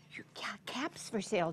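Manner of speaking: softly whispered title. Acted. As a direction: hush it to the kids.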